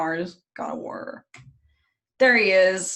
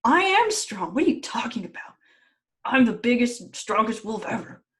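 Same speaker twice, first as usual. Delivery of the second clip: indignant boast; 4 semitones higher than usual. Retold or imitated. Storyteller as Fenrir bragging to Odin, mock indignant. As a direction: puff it up like an affronted braggart. Teller voices boastful wolf, offended.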